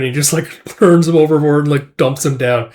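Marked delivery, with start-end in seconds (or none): none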